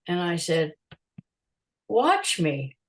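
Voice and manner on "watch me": retold challenge with a swooping pitch; defiant retort. Photographer answering the doubting promoter, defiant. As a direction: throw down a dare in the retelling.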